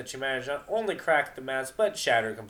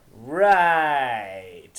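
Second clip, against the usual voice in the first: loud, doubting "Right"; disbelieving retort; sceptical; 3 semitones higher, 11 dB louder than usual. Acted. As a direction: scoff at the excuse. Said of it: "not buying into her excuse".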